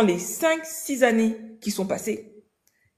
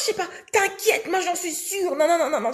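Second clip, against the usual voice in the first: high, airy mock of the friend; imitation de l'amie; 7 semitones higher than usual. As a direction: toss it off like the overconfident friend. Amie imitée, sûre d'elle. (imitation moqueuse, voix haute et légère)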